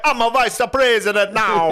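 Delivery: put-on very high, loud Jamaican accent; proud Jamaican accent. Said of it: "do Jamaican accent".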